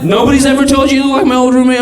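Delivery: ordinary delivery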